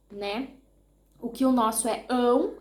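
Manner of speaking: normal talking voice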